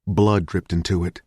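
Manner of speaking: ordinary delivery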